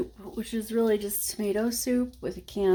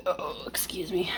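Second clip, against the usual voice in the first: whispered apology; 3 semitones lower, 7 dB quieter than usual. Real, not acted. Apologising after a burp.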